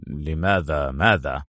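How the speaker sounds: low, slow, puzzled question; bewildered. A narrator's reading. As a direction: ask slowly and deep, at a loss. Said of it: "بدت الحيرة على وجه اليوناني". (بحيرة)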